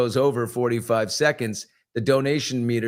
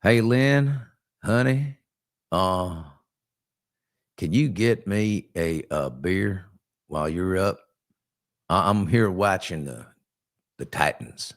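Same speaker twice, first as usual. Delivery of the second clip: mock Southern drawl; 6 semitones lower than usual. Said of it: "relaxing Southern drawl".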